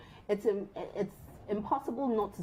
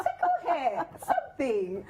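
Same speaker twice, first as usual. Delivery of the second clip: giggly, higher, teasing; playful tickle; 6 semitones higher, slower than usual. Real, not acted. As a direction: say it with a laugh in your voice. Playful advice, laughing.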